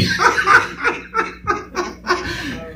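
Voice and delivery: real high-pitched burst of laughter; high laugh mid-story. Real, not acted. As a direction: laugh out at the memory. Telling a funny story.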